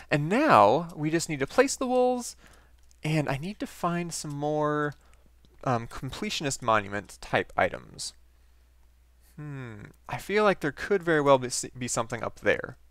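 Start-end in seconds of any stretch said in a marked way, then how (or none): none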